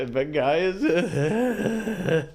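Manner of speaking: slow, high cracking voice; mock voice crack. Host mimicking the guest's voice crack. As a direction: mimic a voice breaking. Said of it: "mimicking the voice crack".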